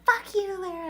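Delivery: high, loud mock yell of his curse; retold yell. Quoting the man who yelled at her, loud angry shout. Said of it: "he yelled at me".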